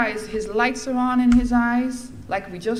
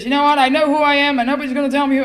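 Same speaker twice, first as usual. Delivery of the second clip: louder, higher, defiant declaration; defiant retort; 6 semitones higher, 10 dB louder, faster than usual. Retold or imitated. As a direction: push back hard at the speaker.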